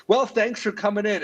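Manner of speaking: high, curt fake-polite host; a perky host cutting him off; mock host brush-off. The host ending his live segment, high fake-polite. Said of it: "she's like".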